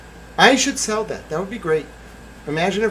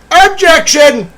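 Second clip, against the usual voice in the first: high, loud, slow hammed-up Objection; mock courtroom objection; 12 dB louder, slower than usual. Streamer playing lawyer in mock outrage. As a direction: cry out like a TV lawyer.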